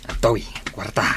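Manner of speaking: whispered aside. Acted. Husband whispers as he hands over the money. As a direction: breathe it out unvoiced.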